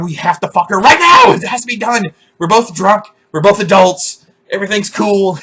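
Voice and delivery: high, loud desperate urging; frantic self pep talk. Retold or imitated. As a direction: talk yourself into it in a panic. Inner panic, pumping himself up.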